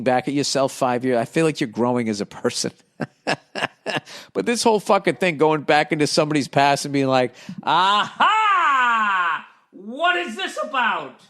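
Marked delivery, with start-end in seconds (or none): 3.0-4.3 short chuckling laugh. Real, not acted. Comedian amused after talking of growing as a person, laughing. 7.6-11.2 very high, drawn-out mock accuser; mock gotcha. Accuser digging into somebody's past, mock triumphant gotcha. Acts out someone exposing another's past.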